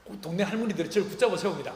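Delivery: ordinary talk, nothing marked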